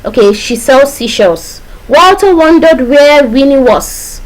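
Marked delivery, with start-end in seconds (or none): 1.9-4.2 slow, higher-pitched example recital; slow, measured tongue twister; demonstration sentence. Shown as a demonstration. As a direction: draw out each W word for the class. Teacher demonstrates an alliteration example.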